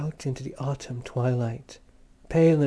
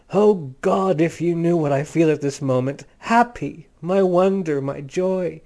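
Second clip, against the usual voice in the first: louder, higher, overflowing joy; a lover who can't contain his joy; ecstatic outburst; 3 semitones higher, 6 dB louder than usual. A narrator's reading. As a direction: cry it out, overwhelmed with happiness.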